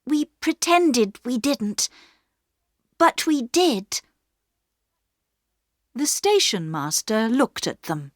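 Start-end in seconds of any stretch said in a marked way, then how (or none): 0.0-4.0 higher, brave confession; girl Bobby. A narrator's reading. Bobby to the station master, bravely confessing. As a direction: own up beside her brother.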